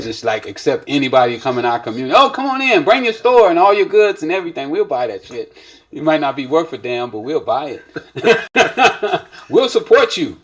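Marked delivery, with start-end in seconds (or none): 2.2-9.2 high, loud, gushing "come on in"; mock eager welcome. Mocking overly welcoming community. 9.3-10.4 laughing it out, high and loud. Real, not acted. Laughs at own bit.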